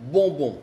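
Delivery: bonbon with nasal vowels, no m or n heard; both syllables bonbon as bo-bo, nasal; French bonbon, nasal vowels; French demo word. Shown as a demonstration. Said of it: "Por ejemplo".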